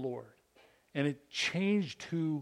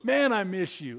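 higher, louder exclamation; exclamation point; 6 semitones higher, 5 dB louder than usual. Retold or imitated. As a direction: exclaim it with emphasis. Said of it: "we use the exclamation point".

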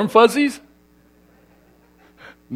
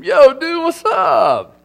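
much higher, loud surfer-dude greeting; Southern Cal dude; 11 semitones higher, 4 dB louder, slower than usual. Shown as a demonstration. Pastor recalling Southern Cal greetings, hammed-up surfer dude. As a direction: hail your buddy on the beach. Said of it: "That was a favorite in Southern Cal".

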